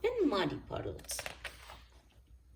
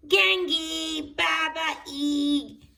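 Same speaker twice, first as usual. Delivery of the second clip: put-on high, loud baby-talk shout; toddler shout; 8 semitones higher, 8 dB louder, slower than usual. A narrator's reading. George greets Granny and Grandpa, loud high baby talk. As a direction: bellow the names like a tiny toddler. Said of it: "shouted George".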